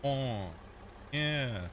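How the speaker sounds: ordinary talk, nothing marked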